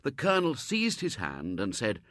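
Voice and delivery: usual speaking voice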